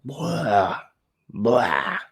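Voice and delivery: mock retching noise; imitated gagging. Making himself throw up for his roommate, imitated gag. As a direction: act out a throaty heave, twice. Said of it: "And I gag".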